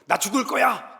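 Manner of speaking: put-on high, whiny cry like a sulking child; 떼쓰는 아이처럼 높은 목소리; childlike tantrum. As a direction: throw a child's fit, pitched high. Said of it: "어린애처럼".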